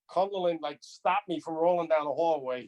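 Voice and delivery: usual speaking voice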